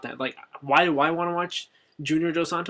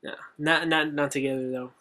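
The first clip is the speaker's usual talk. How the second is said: ordinary talk, nothing marked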